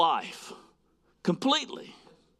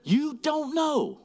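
firm correction; 5 semitones higher than usual. Retold or imitated. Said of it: "I said".

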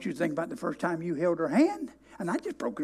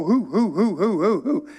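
mock crazy hooting. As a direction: hoot it out, hammed-up and silly. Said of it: "don't y'all go laugh and go".

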